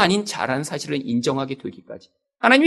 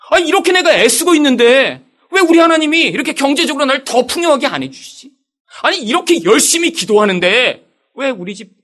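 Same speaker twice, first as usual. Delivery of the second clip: higher, louder aggrieved protest to God; angry complaint; 6 semitones higher, 4 dB louder than usual. Retold or imitated. Pastor reliving his tearful prayers to God, higher and aggrieved. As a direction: demand answers, stung and resentful. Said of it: "너무 억울하고 화나서 울었어요".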